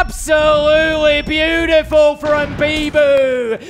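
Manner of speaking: high, loud esports call, key words stretched; excited play-by-play. Real, not acted. Caster hailing a great round for viewers, excited play-by-play. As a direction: roar the praise, then keep the action driving.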